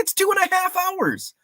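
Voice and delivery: squeaky high put-on wife voice. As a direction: pitch way up, playing the doubtful wife.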